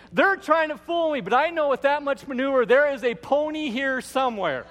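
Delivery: put-on high, loud gleeful child voice; overjoyed kid calling out; excited girl shouting. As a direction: yell it out with pure glee while running. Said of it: "flying through the backyard saying".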